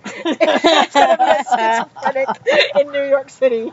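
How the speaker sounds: loud, slowed by real laughter; laughing. Real, not acted. Teller joking with co-hosts about getting stabbed, laughing. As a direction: push the punchline out through laughs. Laughing at her own punchline.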